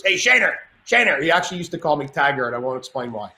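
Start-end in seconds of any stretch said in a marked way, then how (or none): 0.0-1.2 lightly voiced tough Greek buddy, a touch higher; a hint of a gruff Greek friend calling out; friend's greeting, a hint of tough Greek guy. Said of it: "and Jimmy talked like this".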